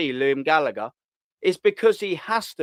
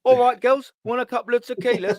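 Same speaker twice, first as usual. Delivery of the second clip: higher, cheeky Irish chat-up line; mock Irish accent; 8 semitones higher than usual. Imitating a player chatting up girls in a mock Irish accent. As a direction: play the Irish lad offering tequilas, pitched up. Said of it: "He does the old, uh, Irish accent".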